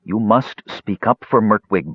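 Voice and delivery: usual speaking voice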